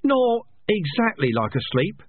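high, slow and unsure; doubting it as you say it; uncertain. A narrator's reading. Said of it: "said a rat, uncertainly".

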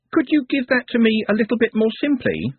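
put-on high girlish voice; young girl voice. A narrator's reading.

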